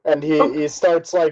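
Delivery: normal talking voice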